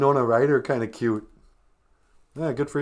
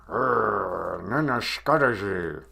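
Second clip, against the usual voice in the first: mock growl through clenched teeth; through gritted teeth; 8 semitones lower, slower than usual. Shown as a demonstration. Host demonstrates saying angry in Ojibwe, gritted-teeth growl. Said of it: "you can say through grit teeth".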